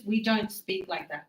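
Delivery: ordinary talk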